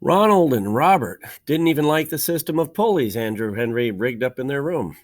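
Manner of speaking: ordinary delivery, nothing marked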